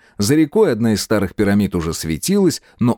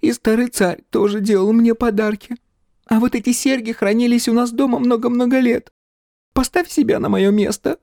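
high-pitched tearful whimper; acted girlish sobbing. A narrator's reading. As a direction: wail it as a crying girl would. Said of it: "всхлипнула Птраси".

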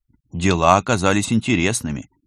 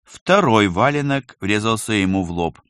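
usual speaking voice

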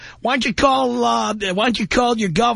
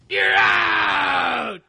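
dismissive shout; 3 semitones higher than usual. Real, not acted. Host hangs up on caller.

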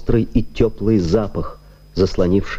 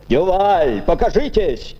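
raised pleading call from outside; 10 semitones higher than usual. A narrator's reading. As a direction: call out to the barricaded man. Gendarme calling from the gate.

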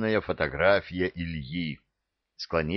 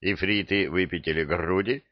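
ordinary delivery, nothing marked

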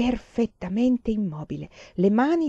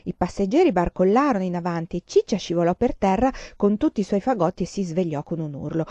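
normal talking voice

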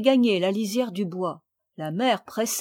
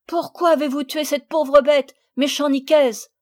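high, loud indignant reproach; 5 semitones higher, 7 dB louder than usual. Acted. Camille reproaching the gamekeeper for the kill. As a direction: accuse him, outraged. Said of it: "dit Camille avec indignation".

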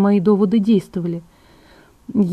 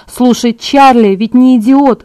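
higher, louder, slow protest; indignant; 4 semitones higher, 8 dB louder, slower than usual. A narrator's reading. Bella argues against Edward's plan, indignant. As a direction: push back with emphatic indignation. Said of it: "возмутилась я".

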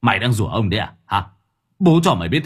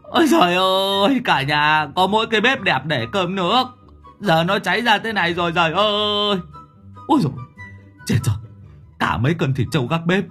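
wailing lament; 8 semitones higher than usual. Acted. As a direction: bawl and lament like a ruined man. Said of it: "nhìn thấy mà mếu máo".

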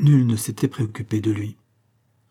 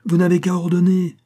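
high, loud and grandly obliging; loud proclamation; 7 semitones higher, 5 dB louder than usual. A narrator's reading. Director assuring the doctor of his help, proclaiming. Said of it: "clama le directeur".

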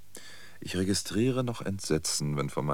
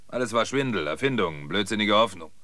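louder, emphatic confession; bitter outburst; 7 dB louder than usual. Acted. Old man confesses it was all a swindle, bitter outburst. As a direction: hammer out each admission.

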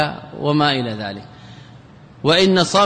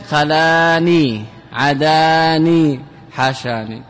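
drawn-out examples; much slower than usual. Shown as a demonstration. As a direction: stretch each form out slowly for the class. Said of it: "slow drawn-out example forms".

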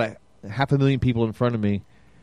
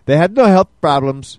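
mock Russian accent; 4 semitones higher, 6 dB louder than usual. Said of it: "you gotta work on your Russian".

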